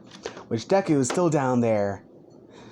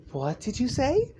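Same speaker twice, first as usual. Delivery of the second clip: high, rising, incredulous query; mother's sharp question; 10 semitones higher, 3 dB quieter than usual. Acted. Inko looking over from laundry, high incredulous question. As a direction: ask it high, rising at the end.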